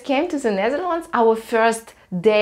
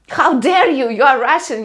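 loud, higher, playfully scandalised; mock outrage; 4 semitones higher, 6 dB louder than usual. Dutch friends scolding the Russian for not drinking, in loud mock outrage. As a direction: act shocked and affronted, hammed up.

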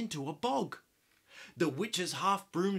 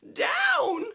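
high, loud cry; witch crying out; 8 dB louder than usual. Acted. Witch orders the broom down, high loud cry. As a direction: yell the command to land. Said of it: "cried the witch".

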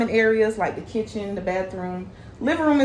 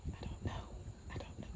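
nervous whisper; 22 dB quieter than usual. Real, not acted. Unsure about the snake.

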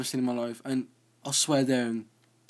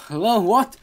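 ordinary talk, nothing marked

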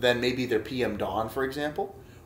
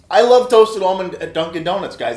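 much higher and louder, bursting with delight; real excitement; 6 semitones higher, 10 dB louder than usual. Real, not acted. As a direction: exclaim it like you just found your favorite flavor. Still thrilled, gushing about the flavor.

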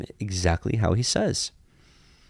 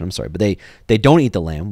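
ordinary delivery

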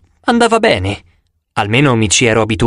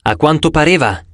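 plain reading voice

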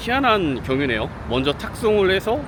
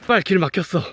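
angry; 4 semitones higher, 4 dB louder than usual. Real, not acted. Cursing a blocked path.

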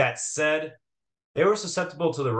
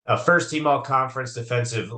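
usual speaking voice

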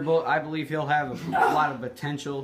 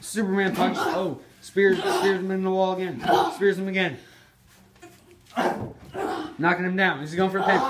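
lively ringside play-by-play; wrestling commentator; 5 semitones higher, 3 dB louder than usual. Acted. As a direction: call the match like a TV announcer.

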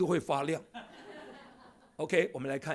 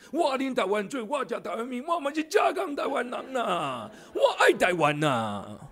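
patriotic cry; 6 semitones higher than usual. Acted. Patriotic Taiwanese slogan, rising shout.

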